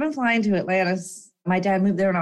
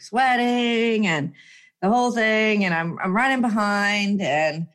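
usual speaking voice

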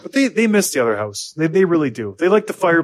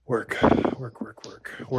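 weary fade; 2 semitones lower, 6 dB quieter, much slower than usual. Real, not acted. Fading list of chores.

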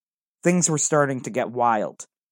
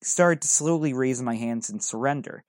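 usual speaking voice

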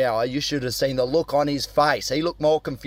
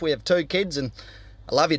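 ordinary talk, nothing marked

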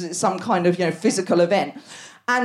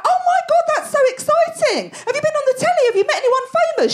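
much higher, gushing; mock starstruck gushing. Doctor gushing at a comedian patient, excited squeal.

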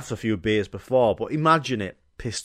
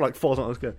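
ordinary talk

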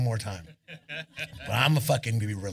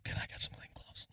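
whispered request; 9 dB quieter than usual. Retold or imitated. Asking wardrobe lady.

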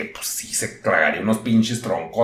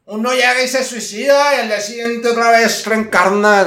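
usual speaking voice

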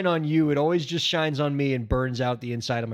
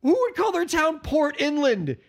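incredulous; 9 semitones higher than usual. Real, not acted. Baffled by town name.

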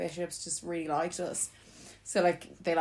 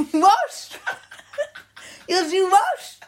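mock outraged yell; 14 semitones higher, 11 dB louder, much slower than usual. Friend shouting from the window.

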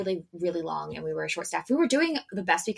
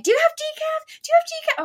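very high, louder squeaky repeat; pushy customer; 6 dB louder than usual. Retold or imitated. Customer at the speaker asking for decaf, very high and nagging. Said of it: "someone in the thing being like".

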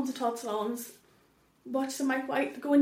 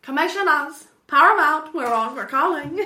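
loud, raised mock appeal; mock call-out; 3 semitones higher, 9 dB louder than usual. Podcaster loudly hamming a pitch to Paramount. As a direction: call out to the studios, hammed up.

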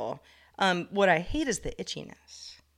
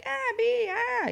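high, startled yelps at a bee; mock panicked squeal at a bee; panicky bee squeal; slower than usual. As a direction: squeal nervously as the bee comes near. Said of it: "I'm doing that thing where I'm like".

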